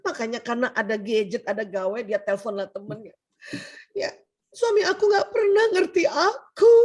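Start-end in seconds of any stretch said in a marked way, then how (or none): 4.5-6.7 high, loud complaint to a friend; hammed-up wifely grievance, pitched up; upset wife, acted. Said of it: "Inilah yang buat perempuan kesel". (istri kesal curhat, nada tinggi dibuat-buat)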